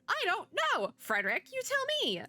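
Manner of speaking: high-pitched, exasperated outburst; exasperated retort. Real, not acted. Player answering the book about the giant spider, exasperated. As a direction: throw the question back, voice shooting up.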